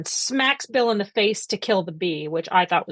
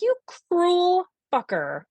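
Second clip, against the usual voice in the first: retold indignation; 9 semitones higher than usual. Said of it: "And I was just like".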